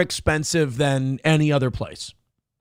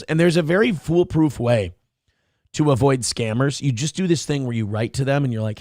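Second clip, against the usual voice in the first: usual speaking voice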